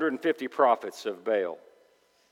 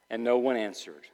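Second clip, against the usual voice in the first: ordinary talk, nothing marked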